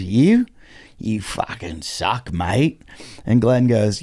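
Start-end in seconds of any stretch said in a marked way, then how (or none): 0.0-2.8 put-on drunk heckler. Drunk heckler turning on Glenn, slow and slurred. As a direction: imitate a drunk dragging out each word. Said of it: "he looks at Glenn and he goes".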